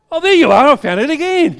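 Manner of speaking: very high, very loud crowing; gleeful gloating. Retold or imitated. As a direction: crow over finding him instantly. Gloating quick find.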